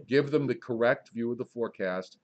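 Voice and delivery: usual speaking voice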